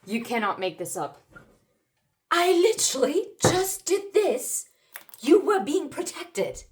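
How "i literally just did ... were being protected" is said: higher and louder, thrilled burst; excited. Real, not acted. Tarot reader thrilled the card matches her words, excited. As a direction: blurt it out, amazed it matches.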